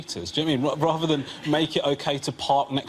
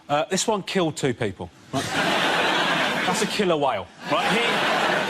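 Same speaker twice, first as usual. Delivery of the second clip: ordinary delivery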